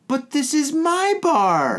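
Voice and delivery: high, drawn-out mock whine; whiny protest. Drunk regular objecting to leaving his bar, whiny. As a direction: imitate yourself protesting, high and slow.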